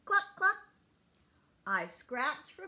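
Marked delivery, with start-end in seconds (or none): 0.1-0.6 very high, loud cluck cluck; squawky hen call for kids. Pretend. Hen sound on chicken page.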